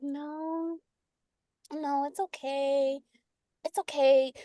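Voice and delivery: soft, drawn-out soothing; gentle reassurance. Acted. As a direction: coax someone gently, slow and soft. Acting the gentle, sugary comforter.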